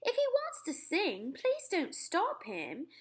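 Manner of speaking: gracious permission; the Queen. Acted. Narrator voices the Queen.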